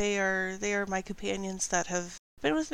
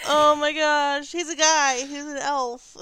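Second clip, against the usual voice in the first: laughing through words; 6 semitones higher, 8 dB louder, slower than usual. Real, not acted. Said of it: "laughing at herself".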